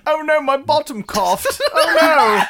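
high-pitched comic English fluster; high, amused "oh dear" voice; mock dismayed Englishman. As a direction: cry out in hammed-up embarrassment. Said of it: "the English, of course".

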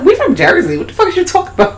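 squeaky annoyed Chucky; high Chucky voice. Retold or imitated. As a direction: snap back like a cheeky puppet. Voicing Chucky.

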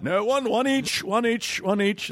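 put-on high, forlorn old-man act; put-on high elderly voice. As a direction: call out to nobody as an old man. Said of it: "That's me as an old man".

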